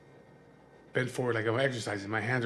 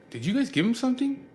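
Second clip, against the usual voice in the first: high, louder mock-wife voice; wife confronting friends; 12 semitones higher, 5 dB louder than usual. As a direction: imitate her sharp, accusing question.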